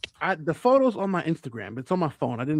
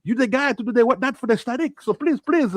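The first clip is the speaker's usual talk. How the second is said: higher-pitched imitation French-Brazilian accent; 4 semitones higher than usual. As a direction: plead in a put-on, weird French-Brazilian accent. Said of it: "he has, like, a weird French Brazilian accent".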